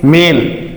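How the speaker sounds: मेल with a slightly long "ए"; long vowel. Shown as a demonstration. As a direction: hold the "ए" in मेल a little. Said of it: "sound क्या थोड़ा long है". (मेल में "ए" थोड़ा लंबा)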